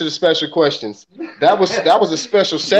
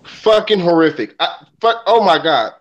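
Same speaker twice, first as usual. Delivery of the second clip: normal talking voice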